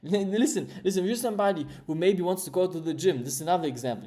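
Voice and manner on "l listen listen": normal talking voice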